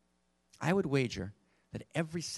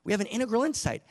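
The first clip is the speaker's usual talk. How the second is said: a much higher, louder burst of discovery; thrilled, higher and louder, over a new insight; excited; 10 semitones higher, 6 dB louder than usual. Retold or imitated. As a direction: gasp it out like a sudden insight. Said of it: "when we get excited".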